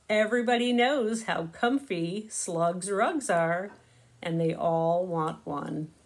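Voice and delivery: ordinary delivery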